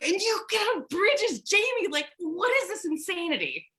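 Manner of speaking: high, swooping disbelief; incredulous amazement. Real, not acted. Host marvels at ironworker friend climbing bridges, incredulous. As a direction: marvel at it, pitch leaping up.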